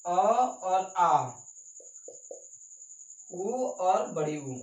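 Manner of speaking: very slow, each sound drawn out; slow dictation. Shown as a demonstration. Teacher dictates the second rule, very slowly. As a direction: spell it out slowly for notes. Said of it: "दूसरा".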